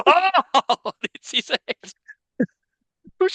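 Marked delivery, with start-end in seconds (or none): 0.1-0.4 short high, loud comic scream; imitated yell. Retelling the brother jumping up, imitated yell. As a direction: yelp loudly as if jumping up. Mike jumps up, retold.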